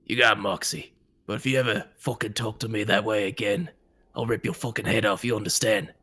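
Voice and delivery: fast, threatening warning; menacing boss. Acted. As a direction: threaten her with a grudging respect. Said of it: "he'll say".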